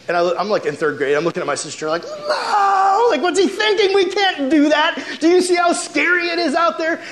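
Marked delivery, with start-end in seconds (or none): 2.0-7.0 put-on high, panicked little-girl protest with a laughing cry; scared kid. Third grader begging brother not to enter the cemetery, panicked and high. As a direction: plead in terror, much higher. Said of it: "And I'm freaking out".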